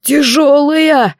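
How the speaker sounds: высоко и протяжно, с натугой; протяни слово, будто тащишь тяжесть; натужное восклицание. A narrator's reading. Призрак натужно тащит тело. (натужно, протяжно)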